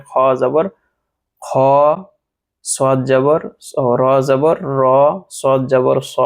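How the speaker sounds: খ, র, স, দ, ত, জ, গ, ক each ending in a rounded "aw", not "aa"; no a-vowel. Shown as a demonstration. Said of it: "আকারবিহীন হবে". (আকারবিহীন গোল উচ্চারণে খ, র, স, দ, ত, জ, গ, ক)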